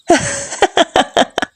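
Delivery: airy unvoiced mocking laugh; breathy taunting laugh; mocking giggle. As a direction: laugh at his useless anger. Mocking his anger.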